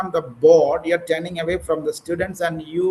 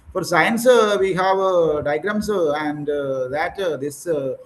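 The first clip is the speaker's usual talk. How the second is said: imitating hesitant fillers. Trainer showing a student her verbal habit, imitated hesitant fillers. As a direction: mimic a halting speaker, an "uh" after each phrase. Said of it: "that is something we have to manage".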